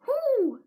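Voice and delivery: loud high whoo of exasperation. Real, not acted. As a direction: let out a sharp high whoo. Exclamation before confessing anxiety.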